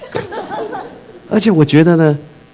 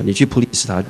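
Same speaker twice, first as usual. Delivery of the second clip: normal talking voice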